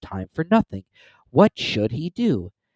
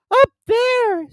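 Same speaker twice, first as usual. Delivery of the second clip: loud, very high frightened squeak; Piglet; 8 dB louder than usual. A narrator's reading. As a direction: point out the danger in a tiny squeal. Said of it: "said Piglet".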